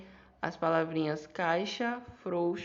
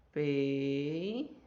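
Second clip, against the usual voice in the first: Peixe broken into Pei and xe, a pause between; split into syllables; 3 semitones lower than usual. Shown as a demonstration. Teacher to student, splitting Peixe into syllables. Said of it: "Vamos lá pra divisão silábica".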